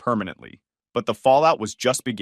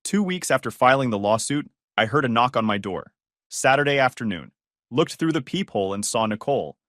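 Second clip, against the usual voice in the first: usual speaking voice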